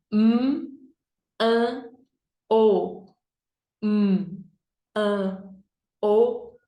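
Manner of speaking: M, an, o as separate "mmm, ã, o"; letter sounds. Shown as a demonstration. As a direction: sound out each letter with pauses. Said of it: "fazer o sonzinho das letras". (sonzinho de cada letra: "mmm, ã, o")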